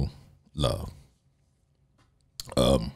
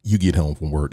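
normal talking voice

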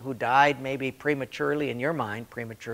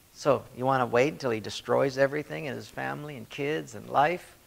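normal talking voice

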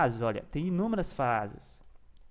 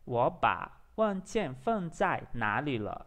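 slow Mandarin with gaps between words; practising pronunciation; 2 semitones lower, much slower than usual. Shown as a demonstration. Learner practising one phrase for class, slow and careful. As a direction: rehearse it slowly, piece by piece. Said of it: "tô praticando essa frase aqui agora".

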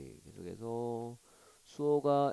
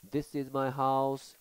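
slow clear reading; 3 semitones higher, 5 dB louder, much slower than usual. Shown as a demonstration. Teacher reads dialogue slowly.

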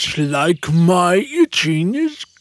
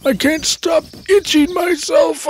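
high, whiny itchy lament; itchy lion moaning he can't stop; wailing lion; 6 semitones higher than usual. Acted. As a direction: whimper and half-shout in misery.

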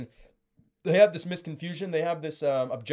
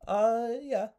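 confused customer; 8 semitones higher than usual. Retold or imitated. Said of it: "Customer says".

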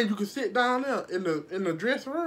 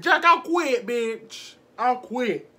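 fiery clapback, pitched up; sassy retort; 3 semitones higher, 4 dB louder, much slower than usual. Retold or imitated. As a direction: throw it back at him, done with it. Quitting on the boss.

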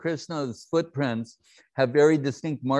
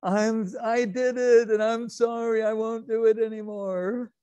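put-on high, sobbing little boy's apology; a small child whimpering sorry, much higher; crying child Krishna; 10 semitones higher than usual. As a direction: plead like a tearful toddler caught out. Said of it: "Krishna's going like this".

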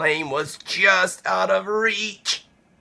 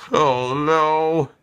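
ordinary delivery

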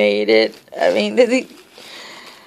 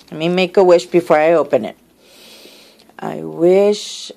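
ordinary talk

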